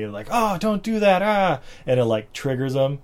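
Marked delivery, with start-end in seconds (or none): 0.3-1.6 high-pitched scolding; mock stern telling-off; imitated stern mom. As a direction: scold a child in a put-on mother voice. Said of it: "like, sternly".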